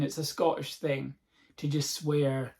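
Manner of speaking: normal talking voice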